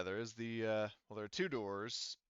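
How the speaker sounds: ordinary delivery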